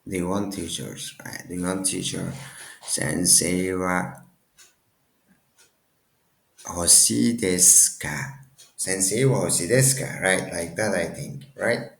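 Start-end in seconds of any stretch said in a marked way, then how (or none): 2.9-8.4 slow, with a long pause mid-phrase; slow, piecemeal. Shown as a demonstration. Builds example phrase piece by piece. 8.8-10.2 brisk run-through in one go; quick, joined up. Shown as a demonstration. Repeats the whole phrase run together.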